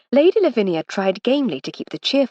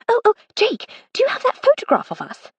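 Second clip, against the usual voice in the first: much higher, bursting with excitement; excited; 5 dB louder than usual. A narrator's reading. Old schoolfriend suddenly remembering the photograph, much higher and excited. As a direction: squeal the question out eagerly. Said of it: "asked Miss Titmuss excitedly".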